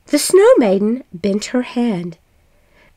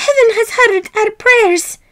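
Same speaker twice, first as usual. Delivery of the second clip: joyful wife; 11 semitones higher than usual. A narrator's reading. Wife overjoyed at the miracle.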